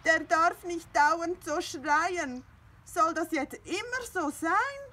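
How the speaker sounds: high, loud whiny plea; whining. Acted. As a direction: beg like a wailing cat. Findus keeps whining.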